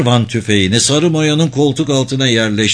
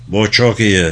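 lightly voiced cement-mixer rumble; a touch of thick, noisy rumble; thick rumbling voice; 3 semitones lower than usual. A narrator's reading. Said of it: "Kalın sesi bir beton mikseri gibi gürültülü çıkıyordu". (hafif kalın, gürültülü bir ses)

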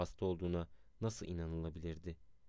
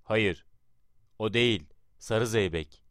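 loud, raised, emphatic correction; roaring command; 4 semitones higher, 10 dB louder than usual. A narrator's reading. Atatürk correcting the confused orchestra, roaring. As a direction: thunder the order at the band. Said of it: "yeniden gürledi".

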